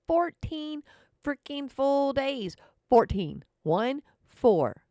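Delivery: FOURTEEN punched loud, each word set apart; loud, high exasperated emphasis on Fourteen; emphatic. Real, not acted. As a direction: hammer Fourteen, then spell out One, four. Hammers home the fourteen days.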